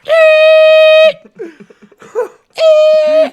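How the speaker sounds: very high, loud siren squeal; air raid siren. Retold or imitated. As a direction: wail like radio feedback. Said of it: "air raid siren noises".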